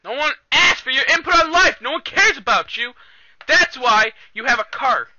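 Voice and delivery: high, loud scolding shout; angry yelling. Real, not acted. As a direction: yell them down in annoyance. Yelling back at interrupting relative.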